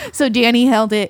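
ordinary talk

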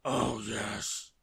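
loud, drawn-out miserable moan. A narrator's reading. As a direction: groan it out, stuffed and sorry. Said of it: "moaned Mister Greedy".

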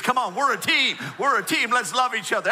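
loud, high, rallying appeal; mock team plea. Player who dropped the ball to teammates, loud pleading. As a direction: plead with the team in comic desperation.

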